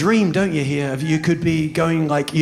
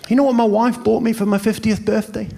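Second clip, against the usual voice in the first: ordinary talk, nothing marked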